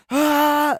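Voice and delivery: sudden high sneeze blast; imitated loud sneeze. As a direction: mimic a big loud sneeze. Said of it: "he was like".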